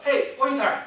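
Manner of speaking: shouted hail across a restaurant, high and loud; a brash yell for service; loud call. Shown as a demonstration. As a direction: call out to the waiter rudely. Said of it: "大声的呼喊说".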